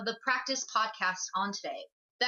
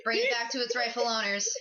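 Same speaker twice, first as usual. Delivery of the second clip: usual speaking voice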